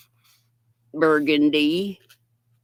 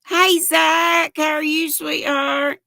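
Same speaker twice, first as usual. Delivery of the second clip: excited greeting; 9 semitones higher, 5 dB louder than usual. Real, not acted. Greeting live viewer.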